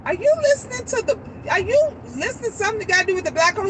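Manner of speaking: high, fast, incredulous mom asking; surprised question. Retold or imitated. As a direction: rush the question out in disbelief. Mother questioning son about video.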